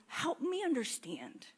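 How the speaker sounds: whispered, humble plea. Retold or imitated. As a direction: murmur it to God. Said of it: "asking God is".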